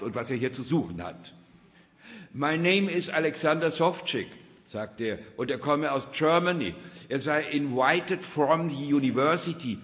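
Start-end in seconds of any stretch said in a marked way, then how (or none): 2.3-4.3 high, loud announcement in English; raised voice, pitched well up; loud self-introduction. A narrator's reading. Introduces himself in English to watchman. 5.4-9.8 high, loud mix of German and English; insistent, raised voice, pitched up; loud self-explanation. A narrator's reading. Explains himself to watchman, half English.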